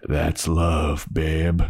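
lightly voiced low tough boyfriend; Spike. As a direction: drop it low for a cool brush-off. Acting Spike's curt answer to Harmony.

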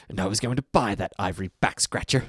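imitated venom, hatred poured into every word; mock-venomous line reading. Quoting Harry Shearer's line to a co-host, venomous. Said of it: "delivered with so much venom".

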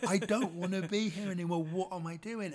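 loud, high mock despair; hammed-up embarrassed protest; exasperated outburst. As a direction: cry out in comic frustration. Acted outburst at doctor's, laughing around.

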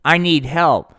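ordinary talk

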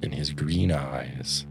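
ordinary delivery

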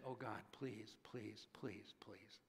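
very low, soft pleading sinking into a whisper; acted prayer, almost under the breath; hushed desperate prayer. Believer in crisis pleading with God, hushed prayer.

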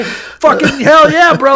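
high, stretched-out mock enthusiasm; hammed-up soldier backing his buddy; hyped-up bro cheer. Acting soldiers excitedly backing their buddy.

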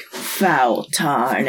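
slow, low game-character voice; drawn-out mock game taunt; Elden Ring character. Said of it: "they always say".

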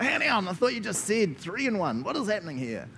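high-pitched mock disbelief; exaggerated baffled protest, pitch jumping up; incredulous objection. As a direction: act out a confused listener cutting in. Voicing an objector's reaction.

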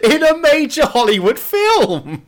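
very high, drawn-out delighted exclamation; excited fan squeal. Acted. Host thrilled to spot a sitcom actor in the film, excited squeal. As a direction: squeal it slowly, sky-high with glee. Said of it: "that's the part that excited me most".

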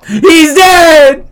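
high, loud, drawn-out cry; mock panicked yell. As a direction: holler it like a kid raising the alarm. Acts kid finding dad dead.

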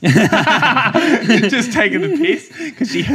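high, slow words through laughter; laughing. Real, not acted. Telling a friend the funny race story, laughing. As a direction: speak while cracking up.

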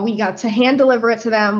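ordinary talk

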